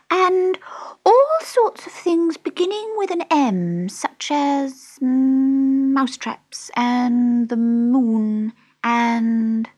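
high, halting, drawn-out mouse voice; drowsy Dormouse. A narrator's reading. The Dormouse listing M things for Alice, sleepy. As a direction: squeak it out, drifting between words. Dormouse rambling through its story.

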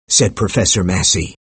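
ordinary delivery

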